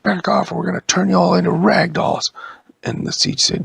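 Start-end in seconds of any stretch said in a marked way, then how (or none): 0.0-2.3 loud threat. Acted. Threatening the trees.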